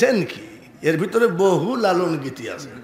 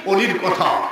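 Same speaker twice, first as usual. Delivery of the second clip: ordinary delivery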